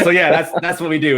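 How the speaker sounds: ordinary talk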